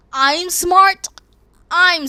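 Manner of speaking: shocked mock outrage; incredulous. Real, not acted. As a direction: react with outraged disbelief. Incredulous at being called smart.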